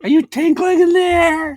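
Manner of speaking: very high, loud parent call; piercing, teasing high-pitched check-in; tinkle voice. Retold or imitated. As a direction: holler it in a silly high voice through the door. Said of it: "that's my tinkle voice".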